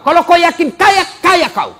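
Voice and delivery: loud, high proclamation; emphatic shout. Real, not acted. Preacher assuring the poor congregation, loud emphatic shout. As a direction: punch the promise home.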